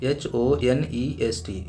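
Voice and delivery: slow, letter by letter; spelling out letters. Shown as a demonstration. Teacher spelling a word for students, slowly letter by letter. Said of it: "अभी क्या है देखो".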